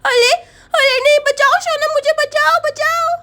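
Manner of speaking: put-on high, loud child's cry for help; panicking little Chinki. Girl begs friend to save her, panicked child voice. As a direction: yelp it as a scared small girl.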